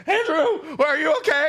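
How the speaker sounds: put-on high, panicked call to a child; imitating a frightened father shouting across water; terrified dad calling. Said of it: "like, terrified".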